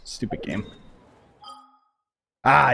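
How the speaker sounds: ordinary talk, nothing marked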